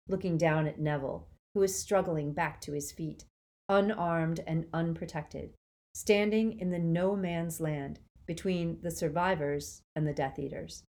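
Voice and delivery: plain reading voice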